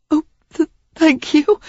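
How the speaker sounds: Sarah confessing to Derek while crying; tearful. A narrator's reading. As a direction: stammer through tears.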